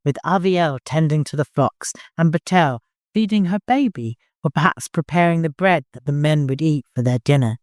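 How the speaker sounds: ordinary delivery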